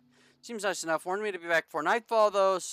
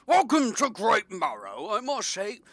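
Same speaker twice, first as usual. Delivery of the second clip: put-on British accent; 5 semitones higher, 3 dB louder than usual. Mayor greets the shipwrecked fisherman, British accent. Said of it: "I don't know why I'm Br-, Br-, British".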